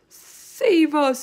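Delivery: high, loud scream for help; drawn-out, high-pitched plea; terrified scream. Acted. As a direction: scream out in nightmare panic. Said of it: "screamed the flesh lump eater".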